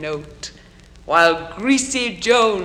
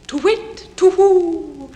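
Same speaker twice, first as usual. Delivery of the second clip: owl's song; 12 semitones higher, 9 dB louder than usual. A narrator's reading. Poem's reader voices the staring owl at night, high singing hoot. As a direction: sing out the call like a hooting owl. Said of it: "then nightly sings the staring owl".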